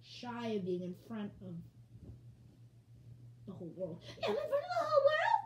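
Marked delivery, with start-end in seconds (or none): 4.2-5.5 very high, loud, rushed panic; Daphne. Pretend. Shy pet realising the world watches, panicked squeal. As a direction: squeal it fast in alarm. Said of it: "Daphne has been here".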